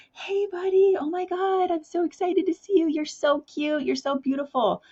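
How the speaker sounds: put-on very high, gushing greeting to a little child; gushing at a toddler. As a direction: gush over your little one like they just ran to you. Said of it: "you'd be like".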